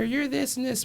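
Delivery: high mocking bully voice; mocking taunt. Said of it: "I've always told people".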